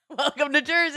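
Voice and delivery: mock welcome. Mock greeting after overheard Jersey guy.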